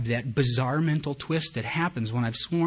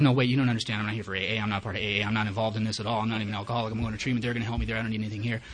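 low, rapid run-on inner protest; racing thoughts; 4 semitones lower, faster than usual. Retold or imitated. Newcomer silently protesting to himself, racing low monotone. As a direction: rattle off the excuses in a low monotone. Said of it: "number of things ran through my head".

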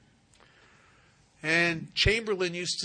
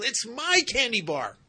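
put-on much higher, much louder child's yell; stubborn little girl shouting; 8 semitones higher, 8 dB louder than usual. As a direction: scream it like a kid clutching her candy. Stubborn girl refuses paramedic.

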